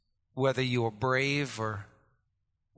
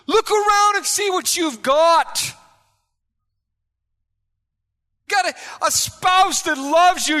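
loud, high exasperated shout; shouting at them; 13 semitones higher, 10 dB louder than usual. Retold or imitated. Preacher yelling at an ungrateful man. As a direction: yell it in disbelief. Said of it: "I'm like".

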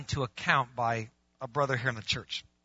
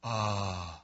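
low, softer stalling "uh"; caught-out guilt; 8 semitones lower, 4 dB quieter than usual. Acted. Man questioned by God, caught-out guilt. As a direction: stall like a kid caught at the cookie jar. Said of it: "like your kid with the cookie jar".

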